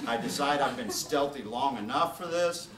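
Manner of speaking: ordinary talk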